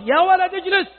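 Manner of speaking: يا ولد اجلس shouted high and sharp; harsh yell at a child. Shown as a demonstration. Father yelling at his son to sit down. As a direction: snap the order at a frightened boy. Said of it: "شفت، لاحظت الصوت؟".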